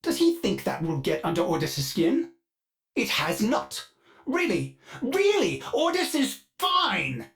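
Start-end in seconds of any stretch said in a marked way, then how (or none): 4.2-7.3 much higher, strained insistence; high, over-insistent denial; protesting too much. Acted. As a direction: insist you're fine while clearly rattled. AI insists it is unbothered.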